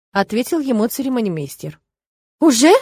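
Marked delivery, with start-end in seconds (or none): 2.4-2.7 high, loud exclaimed question; mother suddenly caught unprepared; sharp jolt of surprise; startled realization. A narrator's reading. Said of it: "спохватилась мама".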